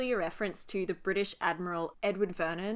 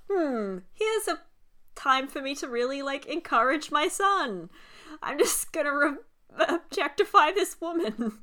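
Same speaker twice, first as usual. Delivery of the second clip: higher, louder mock dad mulling it over; mock proud dad; 6 semitones higher, 4 dB louder than usual. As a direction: voice a dad who thinks he is helping. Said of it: "It's like".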